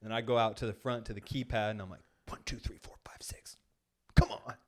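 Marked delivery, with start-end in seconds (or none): 2.3-3.6 whispered counting. Retold or imitated. Counting at the keypad. 4.1-4.5 urgent plea. Retold or imitated. Urging the gate.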